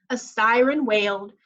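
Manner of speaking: plain reading voice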